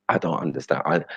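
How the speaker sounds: ordinary talk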